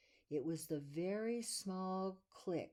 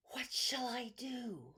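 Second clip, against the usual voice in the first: slow breathy whisper, higher; 8 semitones higher than usual. Acted. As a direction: whisper the question like a sleepy rabbit. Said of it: "he whispered".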